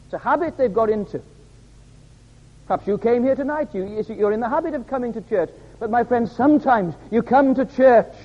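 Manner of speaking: high, urgent appeal to the congregation; impassioned preaching. Acted. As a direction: preach it straight at the listeners.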